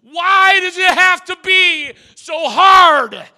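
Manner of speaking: loud, high, slow anguished cry of "Why does it have to be so hard?"; preacher voicing a desperate cry; screamed. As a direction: scream the question out. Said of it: "screamed it".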